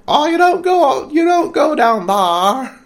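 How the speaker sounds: a bad, squeaky attempt at a Maine accent, voicing the woman. Podcast host voicing a woman from Maine, bad Maine accent. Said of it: "I'm terrible at the Maine accent".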